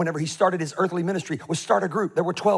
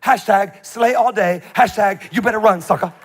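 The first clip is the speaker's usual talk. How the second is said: mock taunting; 4 semitones higher, 7 dB louder than usual. Mocking hashtags of queen's letter.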